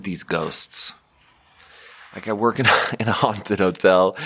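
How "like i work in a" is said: usual speaking voice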